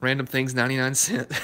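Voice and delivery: usual speaking voice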